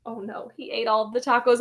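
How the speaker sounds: ordinary delivery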